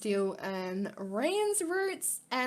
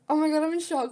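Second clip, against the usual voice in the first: shocked; 6 semitones higher, 6 dB louder, faster than usual. Real, not acted.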